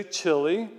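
"Chile" said as CHILL-ee; "Chile" anglicized, ending in "ee"; English pronunciation. Shown as a demonstration. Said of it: "we say it like we're English".

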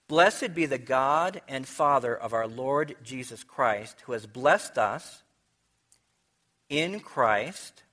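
reading scripture to the congregation, measured and solemn. Acted. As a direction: read the verses out like from the pulpit. Reads verse three aloud.

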